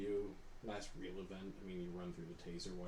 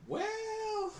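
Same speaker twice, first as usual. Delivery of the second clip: coy; 9 semitones higher, 4 dB louder than usual. Retold or imitated. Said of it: "And I said".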